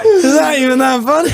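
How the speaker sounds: high, loud laughing question; cracking up while talking. Real, not acted. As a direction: ask it through helpless laughter. Speaker cracking up at a joke.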